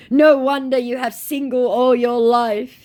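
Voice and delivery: high, slow put-on insult; scammer's insult mocked high and drawn out. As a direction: stretch it out high and mocking. Scammer taunt voiced.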